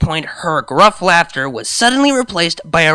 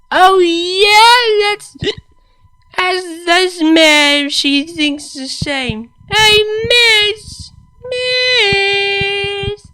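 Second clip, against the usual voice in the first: put-on high, loud, slurring drunk mare; 5 dB louder, much slower than usual. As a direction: play her sloppy and giddy drunk.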